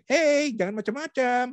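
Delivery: very high, drawn-out cartoon voice; badly faked anger in a squeaky cartoon pitch; SpongeBob. Shown as a demonstration. Coach shows how anger should not sound, silly high SpongeBob voice. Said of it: "Itu SpongeBob kali ya".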